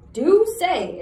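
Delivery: high, loud eager exclamation; exclaiming. Acted. Cutwater jumps at Jemmy's offer, loud exclaiming. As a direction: snap at the idea with sudden interest. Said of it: "exclaimed Cutwater".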